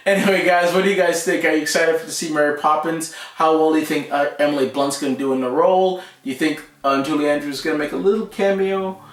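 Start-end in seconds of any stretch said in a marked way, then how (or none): none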